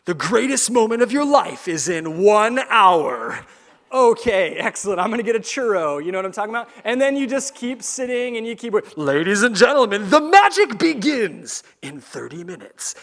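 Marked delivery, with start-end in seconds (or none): 0.0-3.5 park announcer voice. Acted. Announcer to a waiting Disneyland crowd, grand announcement. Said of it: "you hear the little voice". 9.0-13.0 park announcer voice. Acted. Announcer to a crowd that keeps sitting, grand proclamation. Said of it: "you hear the little voice".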